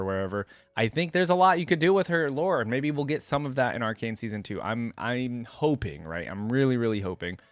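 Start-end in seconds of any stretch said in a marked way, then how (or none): none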